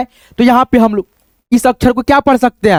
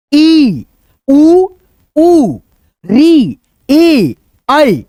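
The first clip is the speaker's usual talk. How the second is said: each vowel called out high and loud, one by one; loud vowel drill; 3 semitones higher, much slower than usual. Shown as a demonstration. Teacher calling vowels aloud for revision. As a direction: belt out each vowel like a class chant. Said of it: "थोड़ा सा इसको revise करने का".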